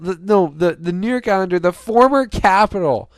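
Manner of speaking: breathless correction mid-celebration; fumbling thrilled shout; excited. Real, not acted. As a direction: still high and loud, tripping over words. Still shouting after the goal.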